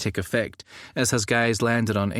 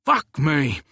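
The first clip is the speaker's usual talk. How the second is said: shocked exclamation; 4 semitones higher, 4 dB louder than usual. Acted. Samuel's first words, shocked.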